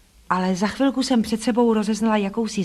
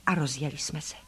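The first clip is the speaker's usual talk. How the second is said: usual speaking voice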